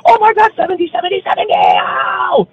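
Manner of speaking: high shrieking squeals, piling on; chorus of excited fans. Retold or imitated. Other women join in, squealing high and excited. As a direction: shriek like a pack of starstruck fans. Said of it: "a chain reaction with the other women".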